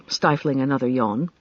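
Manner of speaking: ordinary delivery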